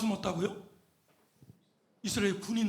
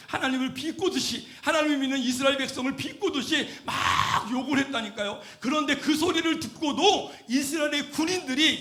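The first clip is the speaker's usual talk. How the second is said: raised, insistent pulpit voice; impassioned preaching; 6 semitones higher, 7 dB louder than usual. Real, not acted. Preacher tells how Israel's soldiers hid in fear, impassioned preaching. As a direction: press each point on the congregation, voice lifted.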